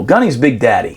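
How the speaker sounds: ordinary talk, nothing marked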